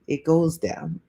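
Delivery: usual speaking voice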